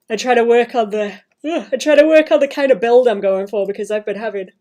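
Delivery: talking through a yawn; stretched, yawning words. Real, not acted. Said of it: "I'm yawning".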